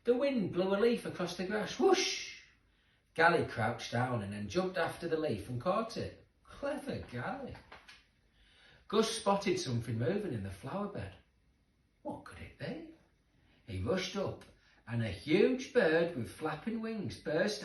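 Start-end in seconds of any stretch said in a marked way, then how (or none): 1.8-2.4 high breathy whoosh of wind. A narrator's reading. Reading to children, a high windy whoosh. 12.0-12.9 high, soft, wondering question; hushed wonder. A narrator's reading. Reading to children, a high hushed question.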